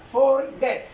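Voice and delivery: "Forget" in two distinct beats, for-get; split into syllables. Shown as a demonstration. Teacher showing the class a two-syllable word, "Forget" syllable by syllable. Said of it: "consta de dos sílabas".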